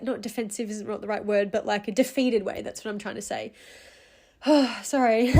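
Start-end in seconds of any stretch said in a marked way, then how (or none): 4.5-5.4 teary sigh into a wet laugh; crying apology. Real, not acted. Apologizing to podcast listeners while crying, teary laugh. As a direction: sob out a sorry, then laugh through tears. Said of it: "get these tears out at the start".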